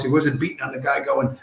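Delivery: ordinary talk, nothing marked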